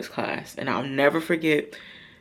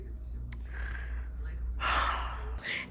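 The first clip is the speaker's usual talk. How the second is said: long, breathy, voiceless sigh; heavy sigh; 5 semitones lower, 12 dB quieter than usual. Real, not acted. After remembering the shame, a long heavy sigh. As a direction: let out a weary breath.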